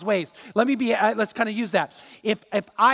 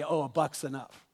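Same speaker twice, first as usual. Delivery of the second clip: a lightly voiced, lower, dismissive tempter; voice of mammon; 6 semitones lower, 6 dB quieter than usual. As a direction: lower and softer, brushing it off as mammon. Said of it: "Mammon would say".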